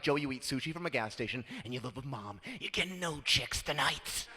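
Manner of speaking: quiet, unimpressed reality check; deadpan put-down. Retold or imitated. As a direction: mutter it flatly to the brother. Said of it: "I'm like".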